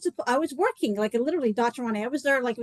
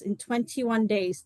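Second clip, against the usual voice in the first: usual speaking voice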